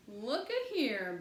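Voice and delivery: loud, slow excited outburst; loud slow exclamation from a boy; bursting out. A narrator's reading. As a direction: burst out at the sight of the gates. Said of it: "burst Klebo".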